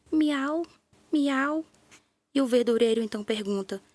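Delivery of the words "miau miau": high, sad little meows; sad meowing. A narrator's reading. Kitten meowing sadly to the vegetable man, mournful meows. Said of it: "começa a miar triste".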